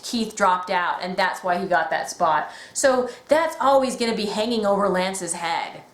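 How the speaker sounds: normal talking voice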